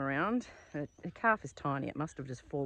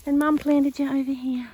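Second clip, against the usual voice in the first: much higher, louder cooing; 10 semitones higher, 9 dB louder than usual. Real, not acted. Talking to calf.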